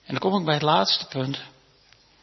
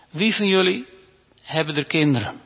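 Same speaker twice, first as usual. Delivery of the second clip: ordinary delivery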